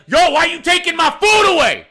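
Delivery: high, loud mock yell; outraged customer. Customer confronting the man clearing his plate, outraged yell. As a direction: imitate an indignant diner hollering in protest.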